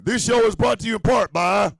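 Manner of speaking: loud, high announcer call-out; booming sponsor intro; ad-read announcer. Acted. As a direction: belt it like a radio host.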